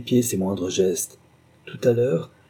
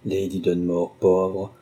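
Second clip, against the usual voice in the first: ordinary delivery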